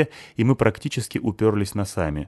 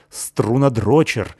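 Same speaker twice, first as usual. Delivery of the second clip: loud, slow angry insult; angry outburst; 5 semitones higher, 5 dB louder, much slower than usual. A narrator's reading. Girl hurling an insult at the bassist, angrily. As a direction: spit the insult at him furiously. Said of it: "злилась Клюся".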